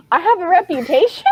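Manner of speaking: high-pitched, thrilled disbelief; adorably excited. Acted. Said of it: "He's, like, so excited about it".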